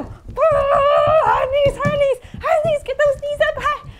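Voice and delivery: high, loud rhythmic urging; excited coaching. Real, not acted. Teacher urges children running high knees, excited calling. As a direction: cheer them on mid-run.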